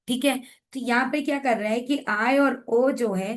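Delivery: normal talking voice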